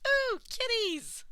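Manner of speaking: very high, loud, slow squeal; delighted squeal. A narrator's reading. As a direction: squeal like a thrilled child. Toga squealing over cats.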